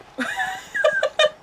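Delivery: high, squealing real laugh; sudden helpless high laughter; burst of laughter. Real, not acted. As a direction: crack up in a high-pitched giggle fit. Streamer cracks up at character.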